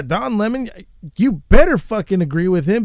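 ordinary delivery

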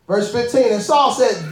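ordinary talk, nothing marked

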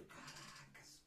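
slow, voiceless whisper; whispered reaction. Retold or imitated. Driver retelling his shock at the crash, whispered. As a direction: whisper it under your breath as a retold reaction.

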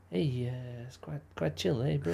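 put-on New Zealand accent. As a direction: play a laid-back Kiwi, lightly. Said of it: "Is that New Zealand?".

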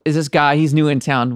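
ordinary delivery

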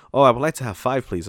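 lightly voiced begging customer; imitating a customer. Tourist pleading with the cashier for five hats, mildly mocking.

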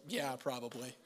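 a light, low-key mopey Eeyore sigh of a line; gloomy Eeyore sheep. Pretend. Sheep answering a predator, lightly voiced glum Eeyore. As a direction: shrug it off like a resigned donkey. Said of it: "Kind of an Eeyore".

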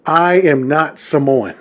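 normal talking voice

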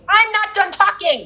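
high, slow indignant protest. Real, not acted. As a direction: insist on finishing, pitched high. Indignant at being cut off.

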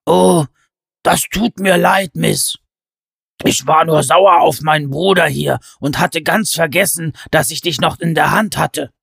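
a big fellow embarrassed; apologetic giant. A narrator's reading. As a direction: giant apologising to the small girl. Pen apologises to Alice.

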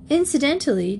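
high, airy, offhand opener; feigned indifference. A narrator's reading. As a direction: drop it in as if unimportant. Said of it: "not as indifferent as she tried to seem".

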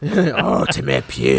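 slow gravelly mock insult; Sergeant Slaughter. Podcaster repeating the wrestler's insult, slow gravelly impression. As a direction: drawl it like a growling promo villain. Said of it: "he called the Ultimate Warrior".

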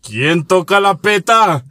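slow, drawn-out call through the door; theatrical challenge. Acted. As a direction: call out who's there, stretching every word. Plays someone answering the door.

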